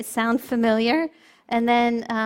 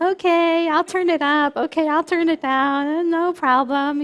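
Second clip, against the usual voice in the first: obliging waiter; 6 semitones higher, 8 dB louder than usual. Retold or imitated. Waiter obliging every request.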